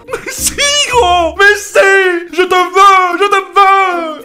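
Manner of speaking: very high, loud hammed-up begging; pleading shriek. Heroine begging the vampire to stay, shrieking. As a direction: shriek like a desperate lover.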